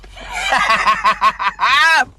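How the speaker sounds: loud, high laughing fit; real belly laugh. Real, not acted. Comic laughs at his rooster joke, loud laugh. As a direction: burst out laughing after a punchline.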